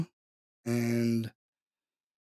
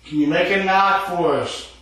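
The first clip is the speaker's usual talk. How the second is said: higher, louder coaxing the ghost; calling to spirit; 7 semitones higher, 6 dB louder than usual. Real, not acted. Ghost hunter asking the spirit to knock, loud and coaxing. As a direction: call out to the spirit.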